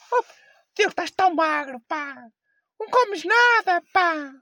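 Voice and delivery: high, slow fussing granny voice; grandma voice. Retold or imitated. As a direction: fret like a worried grandmother. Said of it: "É, mas isso é a tua avó?".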